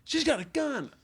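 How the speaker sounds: high excited shout. Retold or imitated. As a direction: blurt it out excited. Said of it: "the guy's like".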